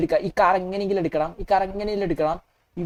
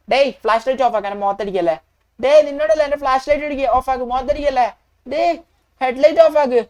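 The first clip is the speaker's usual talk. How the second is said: raised, louder scolding; angry yell; 3 semitones higher, 5 dB louder than usual. Acted. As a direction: yell at a driver, annoyed. Acted quarrel over headlights. (ദേഷ്യത്തോടെ ഉറക്കെ)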